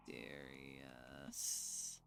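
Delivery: low, slow mysterious murmur. Shown as a demonstration. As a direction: drawl mysterious like a secret.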